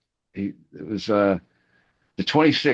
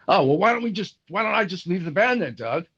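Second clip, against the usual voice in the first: much higher mock huffy threat; 10 semitones higher than usual. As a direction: imitate a sulking singer threatening to quit. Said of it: "He was mad at me".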